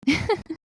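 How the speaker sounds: brief real laugh, no words. Real, not acted. Healer moved by vision of grandmother holding you, laughing.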